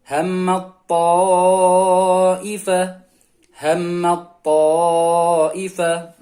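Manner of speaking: "ta'ifa" loud, its first vowel greatly prolonged; ta merged, long vowel. Shown as a demonstration. Teacher models the merger, loud drawn-out recitation. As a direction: merge "Hammat" into a heavy t and hold the aa. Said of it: "jadi huruf ta-nya hilang".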